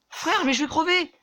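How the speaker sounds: high, loud desperate yelling for help; panicked cries. Real, not acted. Gamer dying and screaming at his teammate, panicked. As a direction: scream for your teammate to save you. About to die in game.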